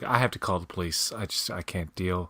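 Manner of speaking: quiet, weary exasperated mother voice. Retold or imitated. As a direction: play the mother at her wits' end. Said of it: "the mother's just like".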